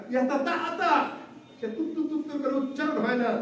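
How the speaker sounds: ইয়াতা তাতা, তুত্তু তুত্তু as high stuttering babble; mock stammer. Preacher mimicking elderly struggling to pronounce Quran, stuttering. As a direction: imitate an old man fumbling sounds. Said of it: "বয়স হওয়ার পরে যার জিহ্বা দিয়ে আওয়াজ বের হয় না".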